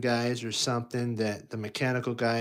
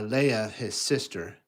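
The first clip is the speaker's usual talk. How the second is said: ordinary delivery, nothing marked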